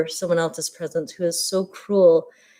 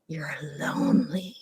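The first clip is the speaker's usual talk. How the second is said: breathy unvoiced whisper, much softer; 4 semitones lower, 11 dB quieter than usual. Sister whispering a cutting remark as she walks off, whispered. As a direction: lean in and whisper the jab.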